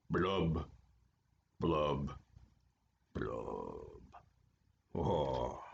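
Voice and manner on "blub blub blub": blub blub blub, dropping lower and softer to nothing; sad fading blubs. Acted. Gloomy fish's blubs fading out after his refrain. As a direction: let each blub sink and die away.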